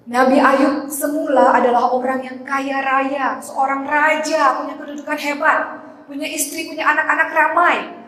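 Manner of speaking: dramatic sermon telling. Acted. Dramatic storytelling at full force.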